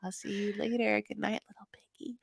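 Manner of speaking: breathy night-time whisper in her ear; soft mock-tender whisper to a lover. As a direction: whisper it like a secret goodnight. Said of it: "you whisper to, in her ear".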